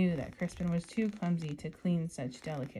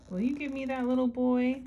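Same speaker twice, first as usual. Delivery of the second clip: man in the moon voice; 6 semitones higher, 7 dB louder than usual. Acted. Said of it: "asked the man in the moon".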